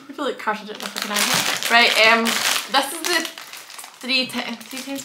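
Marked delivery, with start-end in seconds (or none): none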